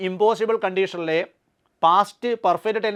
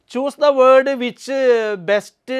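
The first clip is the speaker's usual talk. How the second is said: slow read-out; 3 semitones higher, 5 dB louder, much slower than usual. Shown as a demonstration. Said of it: "teacher reads question aloud".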